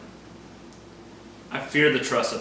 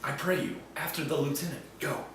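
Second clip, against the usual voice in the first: hushed; 10 dB quieter than usual. Acted. Iago secretly sends Roderigo off.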